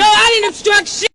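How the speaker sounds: loud furious denial; angry. Real, not acted. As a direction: shout the denial. Woman denies charge angrily.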